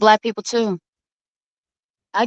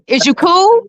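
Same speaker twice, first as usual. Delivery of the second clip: slangy J P version, higher; imitating J P; 5 semitones higher, slower than usual. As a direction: toss it off like J P. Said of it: "you had it right".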